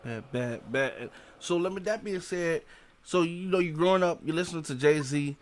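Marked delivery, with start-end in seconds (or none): none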